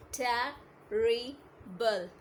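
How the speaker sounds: Terrible stretched very slowly, each syllable drawn out; teacher slowly sounding out Terrible. Shown as a demonstration. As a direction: draw out Terrible so every syllable is heard. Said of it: "It has three vowel sound".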